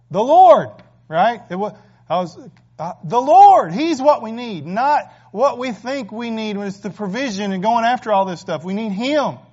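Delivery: loud, high, fervent preaching; full-throated pulpit preaching; preaching at full force. Acted. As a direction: drive it home to the congregation.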